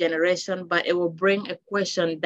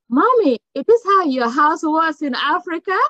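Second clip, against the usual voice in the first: put-on child's question; 8 semitones higher, 4 dB louder than usual. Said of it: "they just keep looking like".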